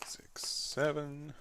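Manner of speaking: slow whispered count under breath. Real, not acted.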